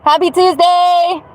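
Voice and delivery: high, slow singsong Happy Tuesday; singsong greeting. Acted.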